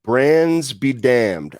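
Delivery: drawn-out, mock-grand slowness; slow dramatic declaration. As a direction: stretch each word for effect.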